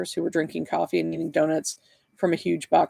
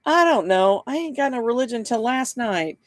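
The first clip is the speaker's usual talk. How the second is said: high-pitched, unschooled true believer; confused believer; 6 semitones higher than usual. A narrator's reading. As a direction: insist on it in a high, simple voice.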